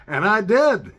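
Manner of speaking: loud, high boast; emphatic. Real, not acted. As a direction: proudly hit it. Emphatic admission.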